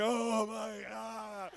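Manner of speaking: drawn-out, stuttering panicked moan; hammed-up dread trailing into shudders; freaking out. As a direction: moan it slowly in comic terror. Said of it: "I was freaking out".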